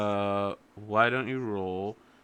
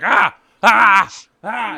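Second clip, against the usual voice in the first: high, very loud "Ah!" barks; frantic dog yelps; 10 semitones higher, 15 dB louder, much slower than usual. Acted. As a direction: yelp sharply with each leap. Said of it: "leaping at each of you".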